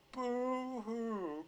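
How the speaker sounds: high, long fake wail; mocking boo-hoo. Commentator jeering at hate crime complaint, mock crying.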